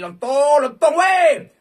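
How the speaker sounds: loud, high taunting yell; shouting. Real, not acted. Streamer yelling insults at the slot machine, loud and high. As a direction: holler at the machine.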